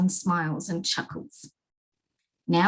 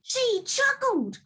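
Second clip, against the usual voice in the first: much higher, slow wailing complaint; king's high cry; slower than usual. Acted. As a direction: wail it like a hurt child. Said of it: "cried the king".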